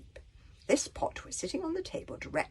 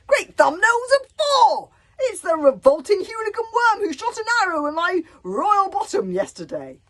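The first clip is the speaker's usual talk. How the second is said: outraged shout; 12 dB louder than usual. A narrator's reading. Warlord recognises the boy who shot him, outraged shout. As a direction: bellow the recognition.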